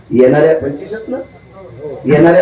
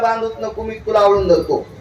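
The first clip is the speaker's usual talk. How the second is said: put-on tender child; 6 semitones higher, 5 dB quieter than usual. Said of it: "ते म्हणलं".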